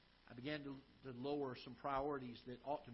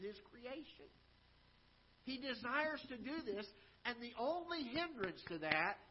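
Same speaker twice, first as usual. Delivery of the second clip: rising preacher's voice, louder and higher; fervent preaching; 7 semitones higher, 5 dB louder than usual. Acted. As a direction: preach it out to the congregation. Preacher builds to full force.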